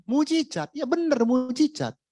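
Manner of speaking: high, emphatic cry of miracle; excited exclamation. Real, not acted. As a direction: proclaim it with lifted excitement.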